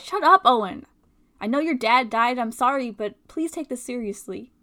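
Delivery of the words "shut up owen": sharp angry shout. Acted. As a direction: snap at him, loud. Angry outburst at Owen.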